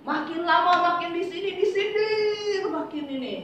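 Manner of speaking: high-pitched mock grievance; fed-up complaint. Congregant tired of constant sarcasm, exasperated complaint. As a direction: imitate someone wailing over endless digs. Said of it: "gitu katanya".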